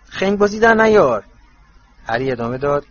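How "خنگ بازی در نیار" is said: high, loud, hasty protest; flustered. A narrator's reading. Ron objecting to Harry's self-doubt, flustered. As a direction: blurt it out in a fluster. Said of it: "رون که به شدت دست باچه شده بود گفت".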